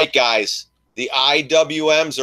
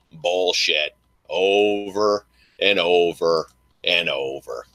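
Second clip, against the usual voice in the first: drawn-out disgust; 7 semitones lower, slower than usual. Real, not acted. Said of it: "drawn-out disdain".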